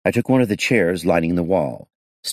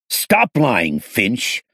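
loud, raised accusing shout; 6 semitones higher, 4 dB louder, much slower than usual. Acted. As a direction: bark it at the suspect. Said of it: "I shouted".

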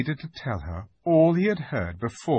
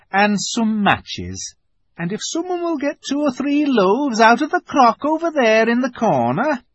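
high, loud, lilting housewife; Mrs Beaver, busily bossy; 13 semitones higher, 7 dB louder than usual. A narrator's reading. Wife directs her husband's packing, busily bossy. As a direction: hand out chores in a raised voice.

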